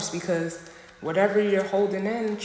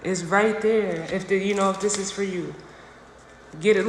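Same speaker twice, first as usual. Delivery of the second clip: ordinary talk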